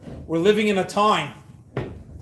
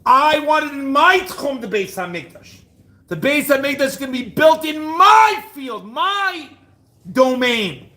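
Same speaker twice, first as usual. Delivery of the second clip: mock brother's claim; 5 semitones higher, 4 dB louder than usual. Brothers' quarrel voiced.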